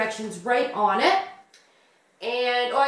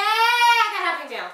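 high, bright hyped-up repeat; excited; 8 semitones higher, 4 dB louder than usual. Real, not acted. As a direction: sell it with a big high lift. Said twice, second hyped.

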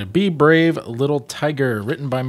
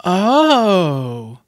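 high drawn-out oh of discovery; small realization; 8 semitones higher, 5 dB louder than usual. Acted. Little tiger watching the elephant, realizing. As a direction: let it dawn on him. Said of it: "said the little tiger as he watched".

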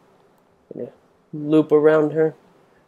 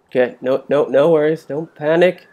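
ordinary delivery

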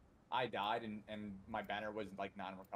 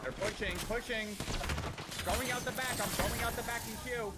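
urgent callout; 13 semitones higher, 5 dB louder than usual. Real, not acted. Urgent callout while team pushes.

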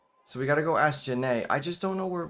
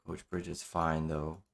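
a lower, softer aside of awe; hushed gasp; 7 semitones lower, 6 dB quieter, slower than usual. Real, not acted. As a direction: gasp then say it low and soft. Reacts to attractive coach.

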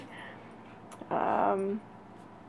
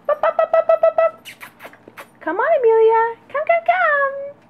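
very high, loud sing-song calling to puppies; puppy calling voice; 7 dB louder than usual. Real, not acted. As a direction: coax the pups with a squeaky high call.

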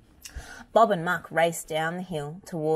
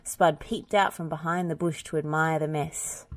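plain reading voice

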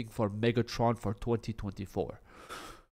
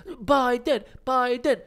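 much higher swooning fangirl call; fangirl squeal; 11 semitones higher, slower than usual. Retold or imitated. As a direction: gush the name like a starstruck fan. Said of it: "Go there and fangirl and be like".

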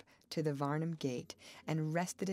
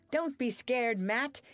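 loud, higher, triumphant shout; exultant cry; 6 semitones higher, 6 dB louder than usual. A narrator's reading. Man to girl as the sled spins past danger, exultant. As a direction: call it out in exultation. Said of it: "he cried exultantly".

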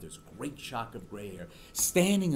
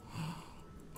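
much softer, breathy mock gasp of the crowd; mock shocked gasp; 12 dB quieter than usual. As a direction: imitate the audience gasping in shock. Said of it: "and they're all".

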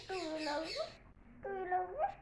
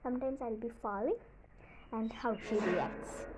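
normal talking voice

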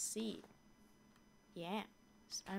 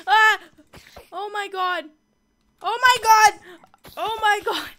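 very loud, high shrieks of fright; terrified screaming; 13 semitones higher, 25 dB louder than usual. Real, not acted. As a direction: scream it out in sheer panic. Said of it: "I'm terrified".

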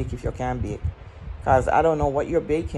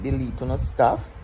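usual speaking voice

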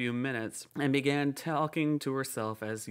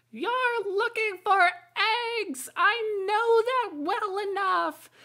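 very high, loud, slow, half-sung bird voice; shrill pigeon; 7 dB louder than usual. A narrator's reading. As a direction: squawk it out like an indignant pigeon.

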